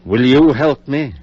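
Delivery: loud, pressing plea; urgent plea. Acted. Surgeon begging his friend for help, loud and urgent. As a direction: push hard for his help.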